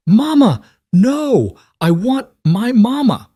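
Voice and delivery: put-on shrill loud child's scream. A narrator's reading. Little girl screaming as she is carried off, high and loud. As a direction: scream for your mother with everything. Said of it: "the little girl's screams started again".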